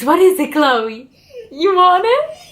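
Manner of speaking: high, laughing coo at the dog; high laughing pet talk. Real, not acted. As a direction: giggle while teasing the pup. Teasing dog with toy.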